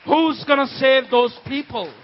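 impassioned question. Acted. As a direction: cry the question out to the congregation. Same question repeated with force.

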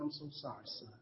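very soft, low apology; hushed apology. Retold or imitated. Father apologizing to his crying son, hushed. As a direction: drop right down to a tender near-murmur.

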